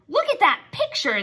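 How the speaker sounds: high, loud command; insist, raising the pitch; demanding. Acted. Mole rats demand Wilbur look, high and loud. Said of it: "they demanded".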